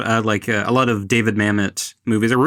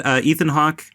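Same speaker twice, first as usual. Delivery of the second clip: ordinary talk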